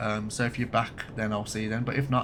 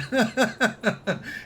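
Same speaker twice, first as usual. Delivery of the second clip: real high-pitched laughter; high burst of laughter; 9 semitones higher than usual. Real, not acted. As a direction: crack up at the joke. Keeps laughing.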